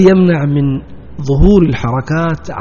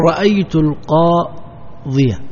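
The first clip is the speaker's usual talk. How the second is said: each word read out slowly and clearly; slow example sentence; 3 dB quieter, much slower than usual. Shown as a demonstration. Teacher reads a grammar example to his students, slow and deliberate. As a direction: spell out the example for the class at half speed. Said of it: "تقول".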